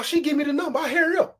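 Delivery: ordinary talk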